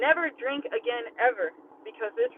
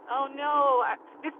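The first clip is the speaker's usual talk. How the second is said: normal talking voice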